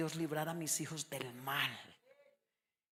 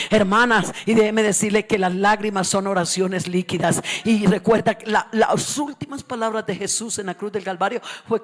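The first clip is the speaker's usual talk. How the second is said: preaching at full force; 3 semitones higher, 18 dB louder than usual. Acted. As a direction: hammer each word to the church.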